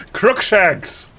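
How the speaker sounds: half-serious melodramatic cry; shouted spell. Acted. Heroine casting a curse at Voldemort, mock shout. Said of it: "I shouted at him".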